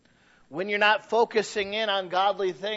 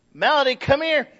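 loud high call of the name; calling a child; 8 semitones higher, 8 dB louder than usual. Retold or imitated. Father imitates calling his daughter Melody, loud and high. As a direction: call her back as she runs off.

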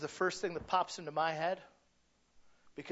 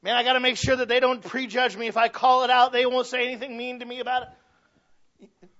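higher, louder fretting inner voice; hammed-up worried people-pleaser; anxious self-talk; 8 semitones higher, 9 dB louder than usual. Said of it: "you've heard people talk like that".

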